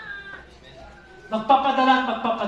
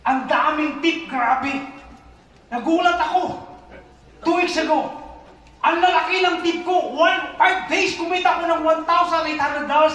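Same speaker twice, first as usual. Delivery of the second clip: excited; 8 semitones higher, 6 dB louder than usual. Real, not acted. Excited testimony about big tips.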